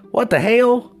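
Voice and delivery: high-pitched comic bafflement; mock disbelief. Reacting to the country voice on the phone, mock disbelief. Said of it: "you know what I was like".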